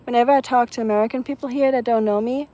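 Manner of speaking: ordinary talk, nothing marked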